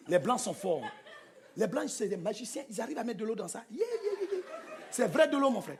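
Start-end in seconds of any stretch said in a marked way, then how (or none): 3.7-4.4 high, soft yelps of wonder; little high cries of amazement; amazed exclamation. Acted. Comic marvelling at bottled water, amazed.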